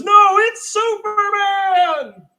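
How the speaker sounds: high, slow, loud proclamation; big drawn-out yell; awed shout. Retold or imitated. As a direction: third guy announcing Superman. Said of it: "the third guy goes".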